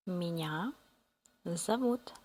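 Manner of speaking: normal talking voice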